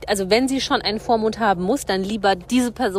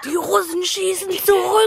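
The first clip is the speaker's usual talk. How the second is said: high, drawn-out mock alarm; mock war cry; 7 semitones higher than usual. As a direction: sound a hammed-up battle alarm, slowly. Mock war cry after insect attack.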